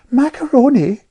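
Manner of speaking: put-on piping know-all child. A narrator's reading. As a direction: pipe up like a little know-all. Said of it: "piped Amelia Tweedale".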